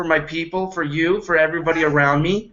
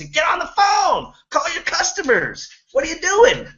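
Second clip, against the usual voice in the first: dealer screaming; 11 semitones higher than usual. Retold or imitated. Dealer screaming at idle salesmen to make calls. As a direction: yell at the salesmen to grab the phone. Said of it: "he would scream at us".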